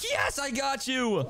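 triumphant high yell; excited shout. Real, not acted. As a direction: shout it in victory. Catches his brother.

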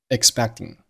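expecting with the k sound held back before t; expecting with a swallowed middle k; unreleased c. Shown as a demonstration. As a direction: stop the c in expecting without a burst. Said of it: "中间的字母c发的爆破音同样失去爆破".

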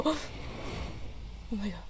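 breathless, slow whisper. Real, not acted. Gamer stunned by the trailer, whispering. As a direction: barely breathe it out in awe.

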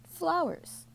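high falling one-word reveal; teasing announcement. A narrator's reading. Granddad answers Sophie's dinner question, high teasing announcement. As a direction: announce it high, then drop.

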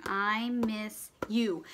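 very slow, word by word; slow sounding-out read; slow dictation. Shown as a demonstration. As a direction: stretch each word out for spelling. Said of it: "Gotta go to my planning".

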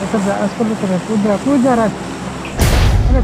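loud shouts at a teammate; urgent shouting. Real, not acted. Gamer yelling at his teammate to jump, loud. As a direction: yell him on to jump.

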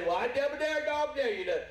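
high, insistent goading; taunting dare. Acted. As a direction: egg them on like a schoolyard dare. Mock dare in a sermon story.

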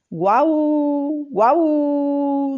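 high barking woof, each one drawn out; dog barking. A narrator's reading. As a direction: bark it out like a faraway dog. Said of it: "Qué extraña voz había oído". (ladrido agudo y estirado, guau guau)

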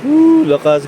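high, loud excited whoop. Real, not acted. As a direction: cheer at the downpour. Reacting to heavy rain.

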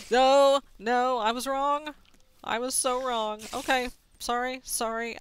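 loud alarmed backing off; panicked. Real, not acted. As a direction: yelp in sudden panic. Startled by a monster.

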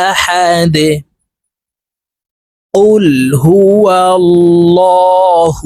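slow, drawn-out chanted verses; Quran recitation. Shown as a demonstration. Teacher recites the full verse for students. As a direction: recite melodically, stretching each vowel. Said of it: "আমি সম্পূর্ণ আয়াত বলে দিচ্ছি".

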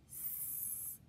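"S" as a soft hiss; first sound. Shown as a demonstration. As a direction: hiss a short "S". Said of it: "tell me the first sound".

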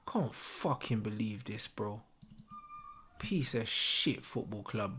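soft, low disgusted grumbling under the breath; bitter muttering. Real, not acted. Fan sulking after his team concedes, soft bitter muttering. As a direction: mutter it to yourself, beaten.

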